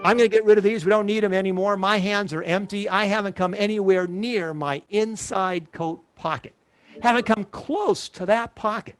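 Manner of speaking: normal talking voice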